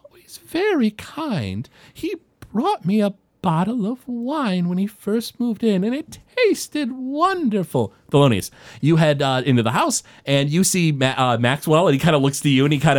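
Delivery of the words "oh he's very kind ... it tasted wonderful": put-on high, slow, breathy elderly woman; an old lady fondly praising a neighbor. Old woman praising Fritz to visitors, high slow voice.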